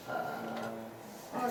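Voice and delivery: "Oh" very low and soft; low third tone. Shown as a demonstration. Teacher recalling third tone, very low. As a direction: drop "Oh" to the bottom, quietly. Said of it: "third tone was that".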